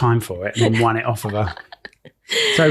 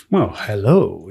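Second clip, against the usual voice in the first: lower, imitating a voiceover artist; 5 semitones lower, slower than usual. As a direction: do a smooth voiceover hello, lightly. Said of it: "he does sound like a voiceover specialist".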